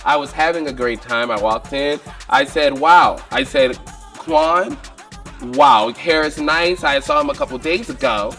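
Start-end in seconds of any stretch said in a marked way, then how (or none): none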